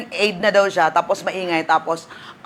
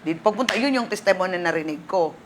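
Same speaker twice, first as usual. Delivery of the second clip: ordinary talk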